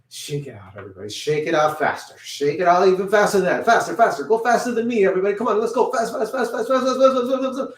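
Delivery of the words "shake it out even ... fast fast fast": high, loud, building to a rapid-fire frenzy; excited urging. Real, not acted. Teacher racing children to shake their hands out, excited and frantic. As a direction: egg them on, higher and higher.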